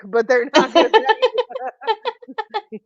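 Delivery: words dissolving into slow, high laughter; laughing. Real, not acted. Host joking with co-host about petting, laughing. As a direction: break into laughter while talking. Cracking up over the petting joke.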